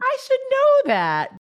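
very high, loud, drawn-out squeal; mock outrage. Improviser mocking themself for not knowing better, shrill mock outrage. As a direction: squeal it in exaggerated self-reproach. Mock self-reproach, echoing the other speaker.

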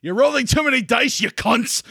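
put-on English accent. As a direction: lay on a terrible English accent. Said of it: "that's my English accent right there".

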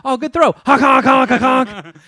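high, loud goofy honks; silly clown honking. Pretend. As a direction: honk like a clown horn. Mock goose honk celebration.

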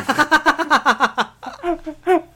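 shrieking laugh, high and loud; high burst of laughter. Real, not acted. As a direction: let out a loud squealing laugh. Burst after niece's punchline.

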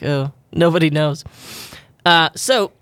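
ordinary delivery

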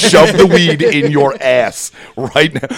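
imitating an exasperated, emphatic instruction; retold emphatic order. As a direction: spell out an order to an idiot, slowly. Brother's order in retold story.

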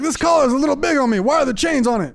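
high, put-on pet voice; high-pitched, puzzled dog character; Greta. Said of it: "Greta's like".

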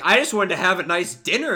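upset Vader parody. Retold or imitated. Host imitating a meme Vader all upset, loud and whiny. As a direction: whine like a sulking villain at dinner. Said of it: "he's, like, all upset".

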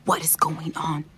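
worried hushed whisper. Acted. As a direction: whisper back in alarm. Maid answers the hushed call.

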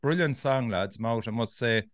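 ordinary talk

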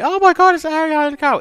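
very high, loud, gushing squeal; excited squeal. Retold or imitated. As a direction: squeal it like a starstruck fan. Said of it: "she was like".

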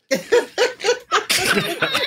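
bursting into a high-pitched laugh; real laugh that breaks up high; cracking up at the absurd answer; loud high laugh. Real, not acted. Laughs at the animal trick.

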